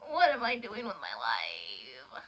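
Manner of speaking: low, slow rhetorical sigh; despairing aside. Real, not acted. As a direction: ask it in deadpan despair.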